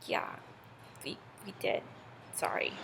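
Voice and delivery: very soft, halting apology; sheepish admission. Acted. Scolded bees to Zeus, sheepish admission. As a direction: own up in a tiny, guilty voice.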